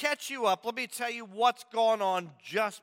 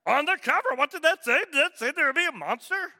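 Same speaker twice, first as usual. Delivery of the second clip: higher, louder anxious puppet monster; Grover imitation; 7 semitones higher, 4 dB louder, faster than usual. Pastor keeps up Grover reading the cover, nervous puppet voice. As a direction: rush the last question, worried. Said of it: "my spot-on imitation of Grover".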